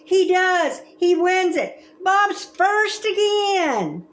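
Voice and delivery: high, loud cheering for the winner; excited cheer. Acted. Kid cheering his brother winning the race, excited. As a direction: cheer him across the line.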